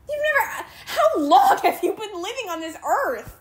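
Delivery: incredulous laugh through the words; laughing disbelief. Real, not acted. Laughing at a young commenter in disbelief.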